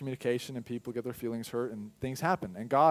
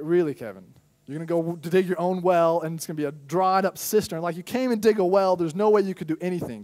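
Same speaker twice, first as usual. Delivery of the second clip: raised, higher scolding voice; firm rebuke; 6 semitones higher, 8 dB louder than usual. Retold or imitated. God rebuking Kevin for digging his own well, firm and raised. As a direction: call him out, half exasperated. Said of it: "And God's like".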